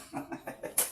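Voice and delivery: soft, breathy chuckle to oneself; soft laugh. Real, not acted. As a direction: chuckle quietly at your own slip. Amused at own pronunciation slip.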